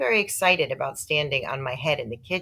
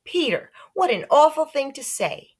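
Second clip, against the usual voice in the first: higher, louder scandalized mom; shocked mother; 9 semitones higher, 4 dB louder than usual. Acted. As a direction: scold with shock.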